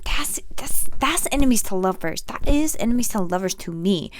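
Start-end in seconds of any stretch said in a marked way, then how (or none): 0.0-0.9 whispered stammer. Real, not acted. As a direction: hush it. Whispered aside.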